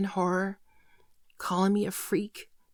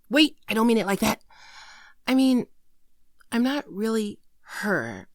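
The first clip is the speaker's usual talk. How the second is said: flustered backpedal; 6 dB louder than usual. Acted. Flustered correction to boyfriend.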